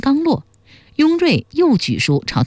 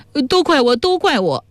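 sobbing remorse; 9 semitones higher than usual. A narrator's reading. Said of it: "魏汉哭道".

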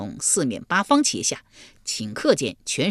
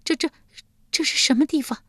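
frightened stammer rising higher; stammering in fright; 11 semitones higher, faster than usual. Acted. Yulian wakes in hell, stammering in fear. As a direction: stumble over the first word, terrified. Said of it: "结结巴巴道".

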